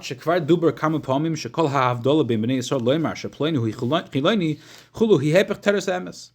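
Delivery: reading Hebrew text. Acted. Reads Hebrew text aloud.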